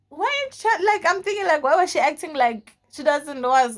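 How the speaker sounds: high-pitched, loud bafflement; retold outburst of disbelief, pitch shooting up; incredulous. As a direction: demand an explanation in squeaky disbelief. Incredulous at cousin pretending not knowing.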